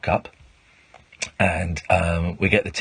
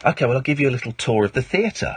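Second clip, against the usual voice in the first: higher, lightly attempted Australian accent; half-hearted imitation of an Australian accent; 4 semitones higher than usual. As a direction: try an Australian lilt, rising at the end. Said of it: "I can't do an Australian accent".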